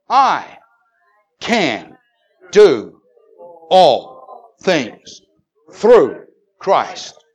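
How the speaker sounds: each word spaced and punched, do and all loudest; call and response. Shown as a demonstration. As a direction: lead the congregation word by word. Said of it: "say it with me".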